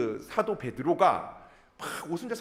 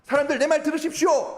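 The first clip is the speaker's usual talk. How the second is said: loud, higher-pitched indignant address; angry Peter; 8 semitones higher, 6 dB louder than usual. Retold or imitated. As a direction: call the mocking crowd to attention. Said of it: "베드로가 화가 나가지고". (화나서 크게 외치는 연설)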